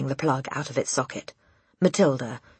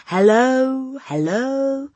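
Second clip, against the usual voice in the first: high, drawn-out parrot hello; parrot calling out; 10 semitones higher, 6 dB louder, much slower than usual. A narrator's reading. Parrot calls down to the girl, high bird call. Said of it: "the bird called down to her".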